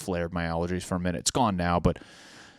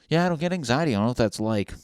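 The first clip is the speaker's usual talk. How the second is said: mock carefree person; 5 semitones higher, 4 dB louder, faster than usual. Mocking people without anxiety.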